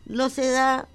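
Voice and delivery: ordinary talk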